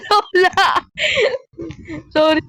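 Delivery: laughing. Real, not acted. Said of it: "sorry sa tawa ko".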